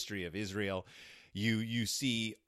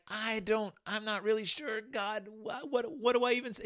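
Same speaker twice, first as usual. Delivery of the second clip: much higher, hesitant stammer; unsure Moses; 12 semitones higher than usual. Retold or imitated. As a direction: Moses at a loss, pitched up. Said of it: "And he's like".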